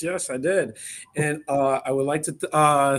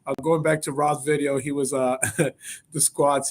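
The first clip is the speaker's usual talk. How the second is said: ordinary talk, nothing marked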